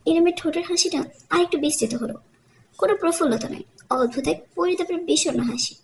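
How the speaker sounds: ordinary delivery, nothing marked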